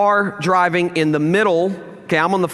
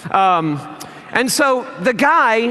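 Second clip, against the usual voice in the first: ordinary talk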